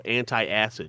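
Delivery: "Antacid" said as an-tie-acid; extra syllable. Shown as a demonstration. Arguing that's what it does, "Antacid" as anti-acid. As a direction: put a "tie" in the middle of "Antacid". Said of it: "adding an extra fucking syllable".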